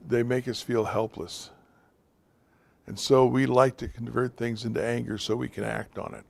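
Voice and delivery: usual speaking voice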